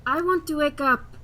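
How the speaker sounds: normal talking voice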